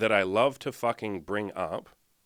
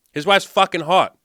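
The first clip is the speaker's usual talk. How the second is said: much higher and louder, hyped up; loud excited exclamation; excited outburst; 8 semitones higher, 11 dB louder than usual. Real, not acted. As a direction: blurt it out, genuinely impressed. Hyping up Nick's wife.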